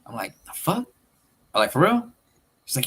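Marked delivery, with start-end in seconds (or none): 0.4-0.9 much higher, rising incredulous question; retold disbelief. Brother hearing police chase his nephew, incredulous. Retold shock at sister's news. 1.7-2.1 high, louder incredulous question; retold disbelief. Brother questioning his sister's news, loudly incredulous.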